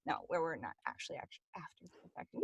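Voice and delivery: hushed, unvoiced aside; whispered aside. Real, not acted. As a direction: whisper it under your breath. Whispered aside correcting himself.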